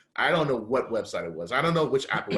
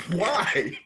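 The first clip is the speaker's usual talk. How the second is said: laughing why; 6 semitones higher, 4 dB louder than usual. Retold or imitated. Retold reaction, laughing.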